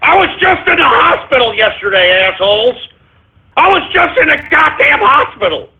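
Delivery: high-pitched indignant outburst; agitated. Real, not acted. Host just out of hospital scolding listeners, agitated. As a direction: lose your cool, voice jumping high. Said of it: "let's just calm our asses down".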